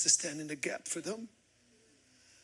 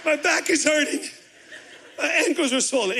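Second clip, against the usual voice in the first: higher, laughing mock gripe; laughing complaint; 10 semitones higher than usual. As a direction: whine through laughter about the pain. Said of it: "I'm like".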